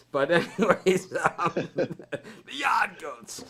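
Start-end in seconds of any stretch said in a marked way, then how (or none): 2.5-3.4 Yard Goats said the Boston way, r dropped, Yahd Goats; Boston accent. Shown as a demonstration. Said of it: "I just love saying Yard Goats in the Boston accent".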